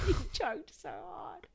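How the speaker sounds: laughing. Real, not acted. Laughing after imitation.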